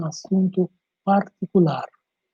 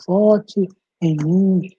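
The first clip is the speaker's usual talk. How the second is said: slow, drawn-out slogan read; slow campaign slogan; much slower than usual. Shown as a demonstration. Teacher giving students a slow sample slogan. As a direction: stretch out each word like a campaign ad. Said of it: "por exemplo".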